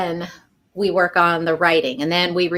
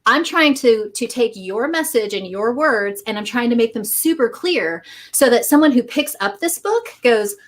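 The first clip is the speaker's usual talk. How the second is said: normal talking voice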